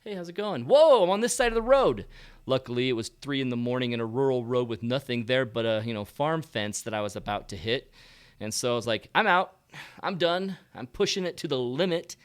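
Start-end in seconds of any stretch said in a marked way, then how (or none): 0.0-2.0 shocked high yelp; jolted awake, suddenly alarmed; startled wake-up. Retold or imitated. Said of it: "I'm like this". 9.1-10.6 emphatic. Retold or imitated. Deciding to stop driving.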